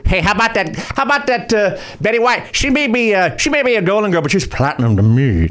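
old-time comedian impression, pitched high; Hope. Warm-up man trading impressions with Winters, high comedian voice. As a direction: do a high-voiced stand-up routine, dropping at the punchline. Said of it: "I'm doing Hope".

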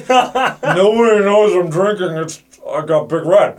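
slow, sly, tipsy boast; sneaky drunk. Pretend. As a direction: slur it like a hiding drunk. Voicing drunk Karaman.

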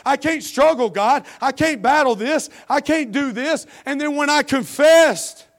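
high, loud, pleading preacher's cry; impassioned preaching. Acted. Preacher confesses his struggle to the congregation, impassioned and pleading. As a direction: preach it like a raw confession before the church.

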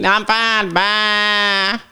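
put-on old Southern woman bleating like a goat; elderly Southern neighbor. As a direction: stretch "Bye" into a high goat bleat. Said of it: "we just sound like livestock".

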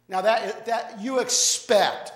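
ordinary delivery

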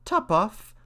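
high mouse exclamation; crying out, mouse. A narrator's reading. Mouse reacts to the odd name, high cry. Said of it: "cried the mouse".

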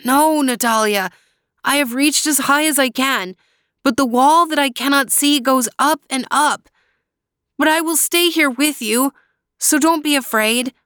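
put-on young brother promising to stay; brave brother reassuring. A narrator's reading. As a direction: comfort your trapped sister. Luis reassures sister.

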